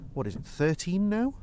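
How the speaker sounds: ordinary talk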